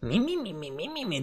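mocking mimimi. Mocking the player's complaint.

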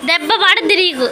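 mock threat. Mother threatens toddler playfully.

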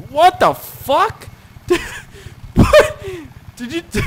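real startled shriek of disbelief; incredulous yelp breaking into laughter; stunned disbelief. Real, not acted. Car flying over the woods.

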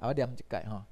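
ordinary talk

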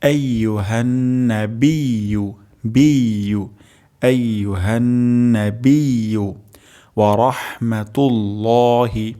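আইয়ুহান্নাবিয়ু stretched slowly with a pushed y; slow stretched recitation. Shown as a demonstration. Teacher recites the phrase slowly for learners. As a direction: recite slowly, each syllable held. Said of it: "তাহলে".

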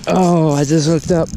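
high and hurried, focused on the fish; excited. Real, not acted. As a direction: talk quickly while fighting the catch. Hurried while reeling a fish.

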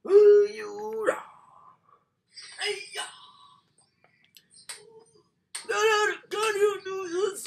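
high, loud, fast panicked protest; panicked yell. Real, not acted. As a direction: shriek at the game not to do it. Panicked gamer yelling at screen.

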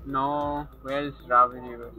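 normal talking voice

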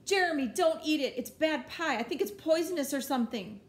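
high, loud, urgent plea; panicked warning. Acted. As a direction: blurt it out in a panic to stop him.

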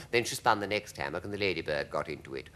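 ordinary delivery, nothing marked

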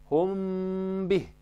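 ikhfa, a hidden m; hidden nasal m. Shown as a demonstration. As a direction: hum the m of "Hum" gently into the b. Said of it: "Îl ascundem".

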